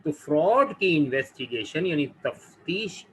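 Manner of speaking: ordinary talk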